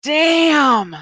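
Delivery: high, loud Southern drawl exclamation. Retold or imitated. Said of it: "said rather loudly in a very Southern drawl".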